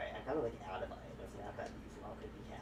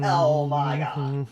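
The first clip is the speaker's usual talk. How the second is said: loud, high shout of disbelief; shocked outburst; 6 semitones higher, 19 dB louder, much slower than usual. Real, not acted. Gamer shocked by the game, loud and high. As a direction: blurt it out, stunned and loud.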